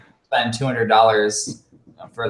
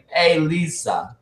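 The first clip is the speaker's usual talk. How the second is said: put-on slow, lightly accented morning greeting; a hint of Russian accent, drawn out; 6 semitones higher, slower than usual. Friend greeting Lisa at breakfast, light Russian accent. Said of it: "you just don't stop talking like that".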